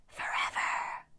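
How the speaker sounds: eerie unvoiced hiss of many nymphs; ghostly chorus hiss. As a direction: hiss the word like ghosts in a cave. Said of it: "the others hissed".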